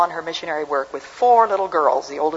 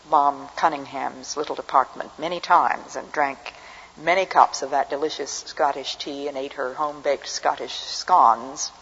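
usual speaking voice

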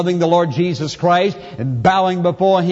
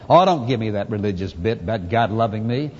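lower, brushing it off in a put-on voice; dismissive sinner; 7 semitones lower, 3 dB quieter than usual. Mimicking a guilty sinner who rejects talk of love, dismissively. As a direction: wave it away like a cynic. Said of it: "what a sinner can't stand".

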